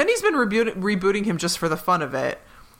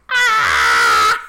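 a sudden low loud yelp of delight; real delighted shout; 9 dB louder than usual. Real, not acted. A fan yelling as the couple returns. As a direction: blurt out a loud "Ah!" of joy. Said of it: "which I was like".

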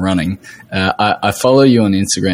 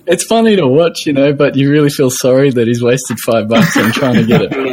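higher, amused, laughter breaking into the words; laughing while talking; 5 semitones higher than usual. Real, not acted. Host recalling a friend's botched coffee orders, laughing. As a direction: talk through real chuckles.

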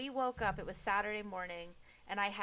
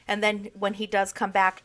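ordinary talk, nothing marked

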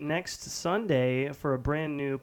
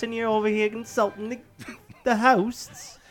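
high British protest breaking into laughter; hammed-up affronted Brit; mock indignation; 6 semitones higher than usual. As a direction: scold in put-on British, cracking up. Mock-offended British host scolds guest.

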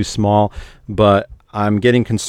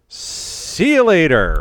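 drawn-out sign-off; 6 semitones higher, much slower than usual. Shown as a demonstration. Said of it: "we'll say".

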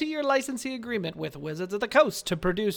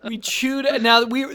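ordinary talk, nothing marked

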